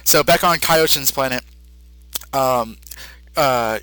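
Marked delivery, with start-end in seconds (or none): none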